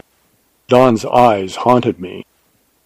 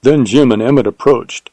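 plain reading voice

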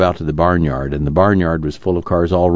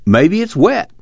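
put-on young man voice; 13 semitones higher, 3 dB louder, slower than usual. Al's voice, high, put-on.

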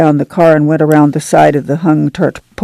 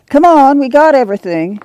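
calling out; 8 semitones higher than usual. A narrator's reading. Ma calls the family to go, calling out. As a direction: call the family along, pitched up. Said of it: "Ma called".

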